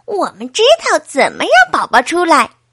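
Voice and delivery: put-on eager kids chiming in; chattering children. A narrator's reading. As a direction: pipe up like a bunch of children. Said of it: "孩子们七嘴八舌地说".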